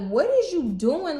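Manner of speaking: high, loud, drawn-out disbelief; mock alarm. Client questioning the tech as her fingers throb, alarmed disbelief.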